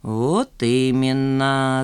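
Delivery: put-on very slow, knowing old man; drawn-out agreement. A narrator's reading. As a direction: savour the words slowly. Старик торжествующе соглашается. (протяжно, многозначительно)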